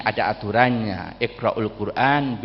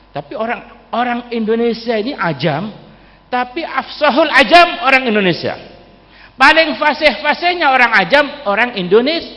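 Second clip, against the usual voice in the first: higher, louder impassioned sermon delivery; fervent preaching; 12 semitones higher, 6 dB louder than usual. Acted. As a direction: preach it out to the crowd with fire. Preacher praising Indonesians' fluency.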